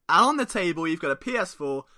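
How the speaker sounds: normal talking voice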